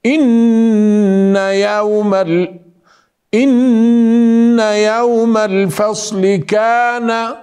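إن يوم الفصل كان ميقاتا, slow, tune only on the nasal ghunna; measured melody. Shown as a demonstration. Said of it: "هنا قلنا كان".

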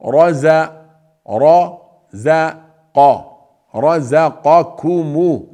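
رزقكم with a heavy, full-mouthed ra, drawn out; heavy emphatic ra. Shown as a demonstration. Teacher spelling out رزقكم slowly for students, heavy ra. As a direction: break رز- رزق رزقكم into slow parts with a thick ra. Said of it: "را مفخم لأنه مفتوح".

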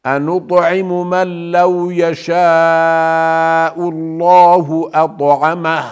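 melodic tajweed chant; Quranic recitation. Shown as a demonstration. Teacher reciting the verse to students, melodic chant. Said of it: "teacher reciting verse".

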